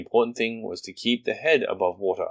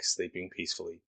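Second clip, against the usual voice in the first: ordinary delivery, nothing marked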